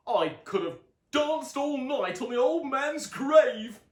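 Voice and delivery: Dad gloats about the grandfather, loud slow glee; Dad, gleeful. A narrator's reading. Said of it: "declared Dad".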